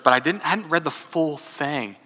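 ordinary delivery

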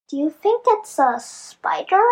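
ordinary delivery, nothing marked

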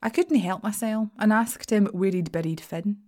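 ordinary delivery

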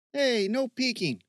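high, joking call. Acted. Steve to his friend in the woods, calling out. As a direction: call it out high across the dark. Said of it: "Steve called out".

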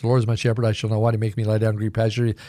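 rapid-fire recitation; real fast. Shown as a demonstration. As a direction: rattle off the Psalm at speed, barely pausing. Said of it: "quote it for you real fast".

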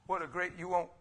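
ordinary talk, nothing marked

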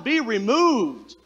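slow, drawn-out command to the mountain; measured decree. Retold or imitated. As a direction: speak it slowly with authority.

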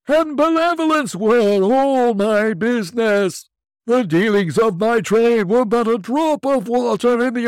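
very high, loud wailing ghost; unearthly voice. Acted. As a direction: wail your regret as a spirit.

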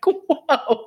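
high, drawn-out whoa; imitated taken-aback reaction; mock alarmed whoa. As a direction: put the brakes on, playfully. Acting wife telling her to slow.

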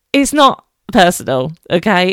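mock firm. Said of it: "I'm joking".

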